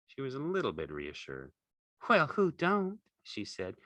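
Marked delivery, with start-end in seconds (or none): 2.1-3.0 high, louder flirty ranch wife voice; Curly's wife.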